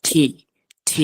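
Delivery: "টি" with a puff of air; breathy th-like burst after t in "টি"; aspirated T. Shown as a demonstration. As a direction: model "টি" as English T. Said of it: "একটু বাতাস বের হবে".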